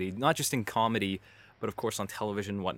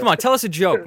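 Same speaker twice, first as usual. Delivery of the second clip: higher and louder, egging him on; pushy teasing; 8 semitones higher, 10 dB louder than usual. Real, not acted. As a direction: come on, needle him into it. Host pushing guest for a joke.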